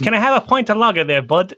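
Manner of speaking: Welsh attempt slipping into Irish; accidental Irish accent. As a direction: try Welsh and land in Irish. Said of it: "That was Irish".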